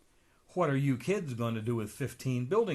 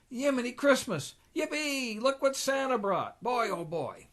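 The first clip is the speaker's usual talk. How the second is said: much higher, squealing with joy; excited kids; 11 semitones higher than usual. Acted. Nephews find their presents on Christmas morning, excited squeals. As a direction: shriek with delight like a small kid.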